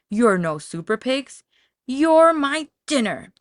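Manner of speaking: loud, rising threatening taunt; menacing taunt. A narrator's reading. Wolf taunting the trapped pigs, loud and menacing.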